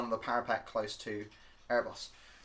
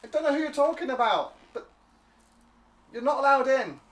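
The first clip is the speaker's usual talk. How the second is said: high, louder kobold refusal; kobold guard; 13 semitones higher, 7 dB louder than usual. Acted. Kobold guard refusing the party entry: high squeaky voice. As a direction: squeak it out, barring the way.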